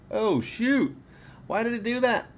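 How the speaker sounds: high, startled yelp of dismay. Real, not acted. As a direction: caught off guard, pitch jumping right up. Light suddenly blows up on him.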